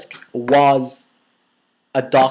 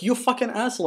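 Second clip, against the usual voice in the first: high, softer mock fury; pitched-up, hushed retold insult; retold anger; 11 semitones higher, 5 dB quieter, faster than usual. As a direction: curse out a careless friend under your breath. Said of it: "I was so pissed at him".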